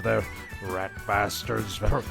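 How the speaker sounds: ordinary talk, nothing marked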